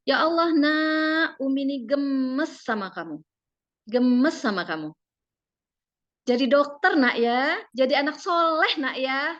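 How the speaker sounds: high, drawn-out fond scolding, pressing each word; gentle scolding mother. Shown as a demonstration. Mother scolding her child with kind words, high and drawn-out. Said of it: "kalau misalnya mau marah".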